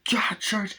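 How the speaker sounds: high, exasperated scolding; mock exasperation. Man scolding his cat, high mock exasperation. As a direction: act out telling off a pet in alarm.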